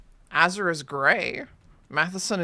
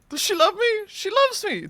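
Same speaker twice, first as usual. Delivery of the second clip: much higher and louder, delighted squeal; squeaky hopeful voice; 4 dB louder than usual. Pretend. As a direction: squeal it like a smitten girl. Petal game alternating voices.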